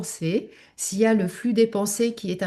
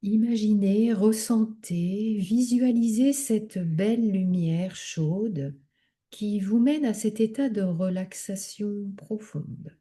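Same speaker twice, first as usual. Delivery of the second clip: calm, measured meditation guidance, drawing out key words; guided relaxation. Acted. Leads yoga nidra relaxation.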